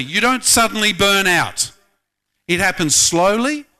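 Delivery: ordinary talk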